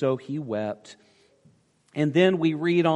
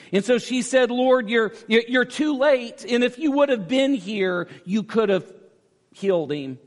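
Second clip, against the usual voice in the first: loud, driving preacher's cadence, pitch raised; full-force preaching; 9 semitones higher, 6 dB louder than usual. Acted. Pastor to congregation, preaching at full force. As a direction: preach it hard to the back row.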